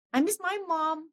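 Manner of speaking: a touch of reliving the sob; retold crying. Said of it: "nangis, senangis-nangisnya".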